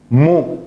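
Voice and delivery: short clear mu with dhamma; Arabic syllable mu. Shown as a demonstration. Teacher modelling dhamma sound, rounded mu. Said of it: "Entonces digo".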